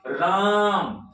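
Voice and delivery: राम very long, stress on रा. Shown as a demonstration. Teacher showing students letter stress, long stressed राम. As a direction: press on रा and stretch राम out slowly. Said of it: "रा पर अधिक जोर पड़ रहा है".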